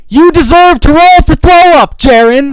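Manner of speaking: loud high-pitched yell; shouting. Retold or imitated. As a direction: yell it at him in outrage. Yelling at a friend in game.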